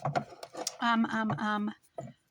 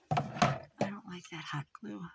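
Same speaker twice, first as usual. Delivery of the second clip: whisper. Real, not acted. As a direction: whisper it while crafting. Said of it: "whispered to herself".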